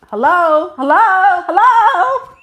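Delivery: escalating high, loud hellos; impatient calling. Acted. Woman trying to wake up a slow suitor, impatient calling. Calling hesitant man to attention.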